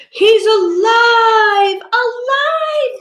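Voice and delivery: high slow sung shout of joy; excited exclaim. Acted. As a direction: crow it like mad scientists. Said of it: "the little terrors exclaimed".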